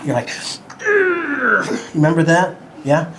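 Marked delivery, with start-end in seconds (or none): 0.3-1.7 high strained growl of rage; angry shaking growl. Retold or imitated. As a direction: grunt like you're shaking with anger. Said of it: "you get so angry you shake".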